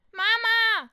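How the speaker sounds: put-on high loud child's call; shrill call up the stairs; child calling mama. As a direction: yell for mommy like a small kid.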